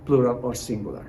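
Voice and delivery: usual speaking voice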